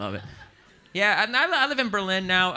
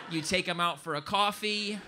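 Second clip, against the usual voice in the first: ordinary talk, nothing marked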